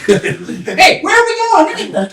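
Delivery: high, questioning call; calling down the line. Acted. People in line asking each other, high and questioning. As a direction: pass the question forward.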